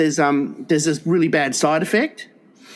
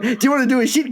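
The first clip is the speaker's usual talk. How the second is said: much higher, eager sing-song; eager owner's question; 10 semitones higher, much faster than usual. Retold or imitated. Owner eagerly asking the dog, high sing-song. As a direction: coax the dog like it's a treat.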